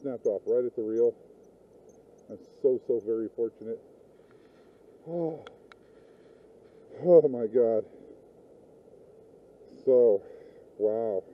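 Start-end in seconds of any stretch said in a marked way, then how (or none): 5.1-11.2 real loud stunned exclamation. Real, not acted. Angler stunned at his snapped line, loud disbelief. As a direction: let it burst out in disbelief.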